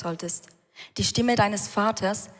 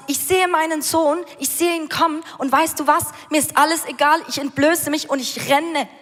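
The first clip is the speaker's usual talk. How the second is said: higher, louder, overjoyed father; excited father; 5 semitones higher, 4 dB louder than usual. Acted. Preacher voicing the father running to his son, elated. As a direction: burst out as a father beside himself with joy. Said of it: "der Vater kennt nichts anderes als".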